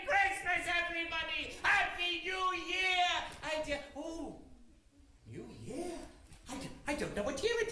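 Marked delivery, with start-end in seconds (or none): 1.6-3.1 slow, high shout of Happy New Year; joyful shout. Acted. Scrooge shouts his greetings. 5.3-5.9 soft, quiet New Year with a questioning rise; puzzled murmur. Acted. Scrooge doubts what year it is.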